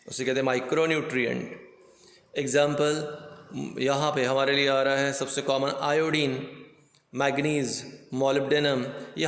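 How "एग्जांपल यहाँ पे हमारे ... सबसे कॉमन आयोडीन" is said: ordinary talk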